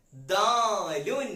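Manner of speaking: very slow, stretched model word; drawn-out letter name. Shown as a demonstration. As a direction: say it long and clear for the class. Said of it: "എന്താണ് അക്ഷരത്തിന്റെ പേര്?". (നീട്ടി സാവധാനം പറയുന്നു)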